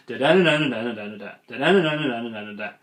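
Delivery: quick nonsense syllables stepping down to a final "da"; repetitive falling pattern. Shown as a demonstration. Coach showing an overdone speech pattern, singsong falling cadence. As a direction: rattle off "Da-da-na-na", pitch sliding down each time. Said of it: "you're already probably hearing the pattern".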